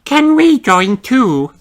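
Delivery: very high, slow little rabbit voice; a tiny bunny asking shyly to join; Rebecca's voice. A narrator's reading. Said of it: "asks Rebecca Rabbit".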